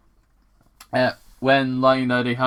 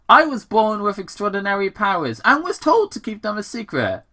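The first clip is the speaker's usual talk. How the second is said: put-on young Sonic; 8 semitones higher, 3 dB louder, faster than usual. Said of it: "Sonic explained".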